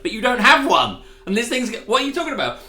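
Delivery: high, loud incredulous outburst; exasperated shout. Retold or imitated. Alien mocking the crew with a high, loud, incredulous yell. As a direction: demand it like they're being absurd. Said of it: "And it's like".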